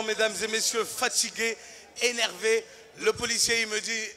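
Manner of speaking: ordinary delivery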